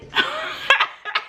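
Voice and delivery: squealing laugh at her own joke; high burst of laughter. Real, not acted. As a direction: cackle up high.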